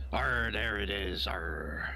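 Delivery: slow, whispered pirate growl. Retold or imitated. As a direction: give it a hushed pirate rasp. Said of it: "talk like a pirate".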